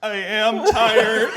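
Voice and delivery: high, loud, slow drawn-out whine; hammed-up weary wail, stretching each word; demonized man's wail. Snake man admitting to Heidi he's tired, loud high wail. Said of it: "And the guy's like".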